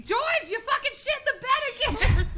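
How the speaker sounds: high shrieked scolding, breaking into laughter; very high-pitched mock scream; screaming wife. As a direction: screech it like an outraged wife. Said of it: "it was his wife screaming at him".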